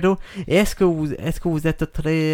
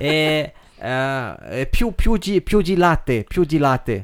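fake Italian accent; much slower than usual. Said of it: "tu parles très bien le faux italien".

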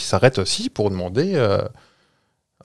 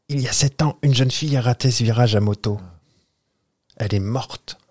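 a softer, lightly spooky horror film telling; 4 dB quieter than usual. Acted. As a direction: drop the voice like a horror movie ghost. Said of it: "je vais faire comme dans les films d'horreur".

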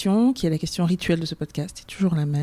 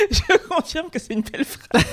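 laughing; 8 semitones higher, 4 dB louder than usual. Real, not acted. Reacting to the funny phrase.

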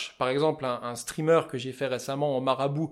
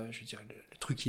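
whispered aside; 4 semitones lower, 17 dB quieter than usual. Real, not acted. Fly-fisher confiding to his podcast host, whispered aside. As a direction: drop to an unvoiced whisper. Muttered aside about sponsorship.

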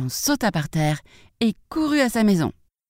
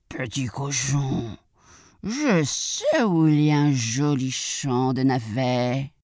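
low, slow wolf voice; sly wolf; 3 semitones lower, slower than usual. Acted. Wolf tempts the piglet with a turnip field, low and coaxing. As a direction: draw out the lure as a scheming wolf.

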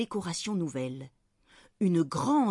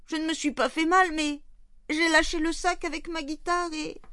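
put-on very high, distraught child's wail; tearful young boy; 10 semitones higher than usual. A narrator's reading. Boy confesses the guitar accident to Fanny, tearful high voice. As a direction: pour out the confession in a high, upset voice. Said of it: "Marius qui semble en pleurs".

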